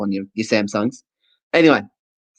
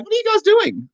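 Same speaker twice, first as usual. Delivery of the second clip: much higher puzzled voice; mock bewildered hearing person. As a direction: squeak it out like a confused onlooker. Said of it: "And they're going".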